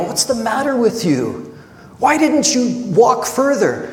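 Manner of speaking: hammed-up frustrated rebuke; mock frustrated. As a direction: poke fun at the harsh reading. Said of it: "this frustrated kind of thing".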